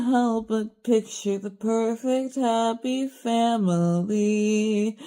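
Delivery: very slow, drawn-out poetic recitation over music; slow, wistful spoken-word reading; slow spoken-word poem. Acted. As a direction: recite it like a mournful poem, stretching each phrase.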